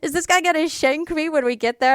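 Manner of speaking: mock terror. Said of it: "I was terrified".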